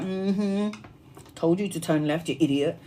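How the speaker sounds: low, soft smug scolding; smug told-you-so. Retold or imitated. Her guides smugly scold her for turning right. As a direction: gloat quietly at the idiot. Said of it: "my guys are like".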